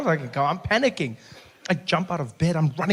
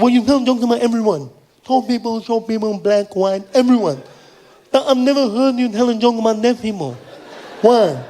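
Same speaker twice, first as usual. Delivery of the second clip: higher voice imitating a deaf man's accent; partially deaf speech; 4 semitones higher, 3 dB louder than usual. Fan admits he's partially deaf, higher deaf-accented voice. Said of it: "thought you had an accent".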